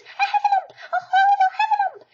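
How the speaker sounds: very high, loud shrieking fright; terrified squeal. A narrator's reading. Piglet fleeing the trap, shrieking in terror. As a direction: shriek it out as you flee in terror. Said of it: "cried Piglet".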